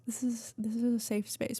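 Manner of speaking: soft whispered reassurance; hushed safe space whisper. Real, not acted. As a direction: whisper it gently to comfort. Whispered repeat.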